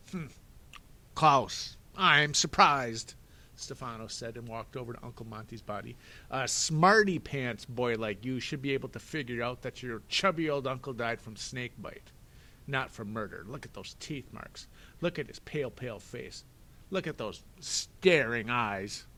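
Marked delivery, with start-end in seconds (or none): 0.1-3.2 loud, slow, higher villain voice; a sarcastic villain pretending to be impressed; mock surprise. Stefano mocking Klaus, slow and loud. 6.3-19.1 louder, mocking villain voice; sneering villain. Stefano taunting Klaus over the uncle's body, sneering.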